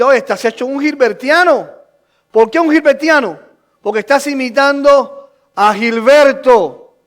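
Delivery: energetic, insistent pulpit delivery; preaching at full force. Real, not acted.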